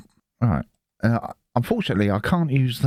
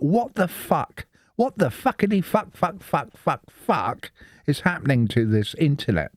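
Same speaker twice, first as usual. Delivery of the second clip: higher, mock-incredulous string of curses; exasperated swearing; 7 semitones higher than usual. Real, not acted. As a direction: rattle off the swears in comic disbelief.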